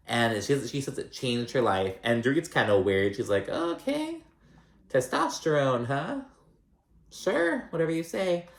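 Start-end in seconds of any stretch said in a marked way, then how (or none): 3.5-8.5 much higher, drawn-out disbelieving voice; mock her with a doubtful sing-song; imitating a dismissive friend; sceptical. Dorit doubting Sutton's claim.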